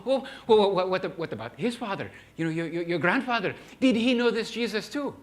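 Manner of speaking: high, loud stammering plea; urgent old man. Retold or imitated. Old shaman questions the missionary, high loud urgent stammer. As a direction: stumble over the words, desperate to know. Grandpa presses again.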